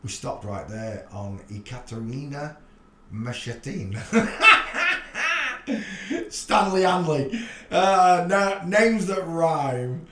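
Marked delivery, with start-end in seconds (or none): 4.0-6.2 cracking up at the name; high laugh. Real, not acted. Laughing at a funny surname. 6.3-10.1 laughing. Real, not acted. Laughing at a silly name.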